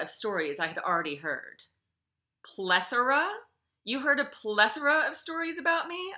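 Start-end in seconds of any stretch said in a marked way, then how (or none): 2.4-6.1 loud, high, incredulous question; raised voice. A narrator's reading. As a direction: throw the word back in disbelief. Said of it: "voice raised".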